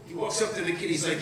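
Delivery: ordinary talk, nothing marked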